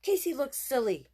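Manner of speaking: mock your brother; big sister scoffing at Casey; scornful girl. A narrator's reading. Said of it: "said Jessie".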